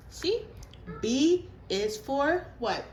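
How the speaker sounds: slow, loud quizzing question; teacher voice. Real, not acted. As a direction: ask the class, drawn out.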